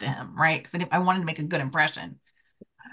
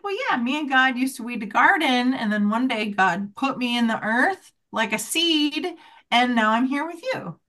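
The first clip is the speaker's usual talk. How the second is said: put-on lightly raised, innocent child's tone; little girl voice; 6 semitones higher than usual. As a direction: play a three-year-old, matter-of-fact. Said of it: "She was like".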